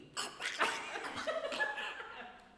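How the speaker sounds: high, unvoiced squeaky beaver call; beaver noise. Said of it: "That's crazy".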